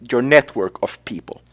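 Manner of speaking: ordinary delivery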